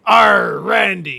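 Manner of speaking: loud, high pirate shout. Pretend. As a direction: bellow it like a pirate captain. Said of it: "talk like a pirate".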